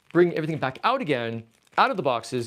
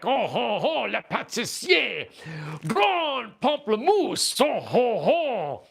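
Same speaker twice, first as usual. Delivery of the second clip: much higher, slow cartoon French babble; mock French accent; 7 semitones higher, much slower than usual. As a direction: ham up fake French words like a stage Frenchman. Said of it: "My French is pretty good".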